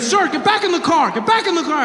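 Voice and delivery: high, urgent order to get back; a scared policeman yelling commands; alarmed officer. Retold or imitated. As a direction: bark it like a rattled cop.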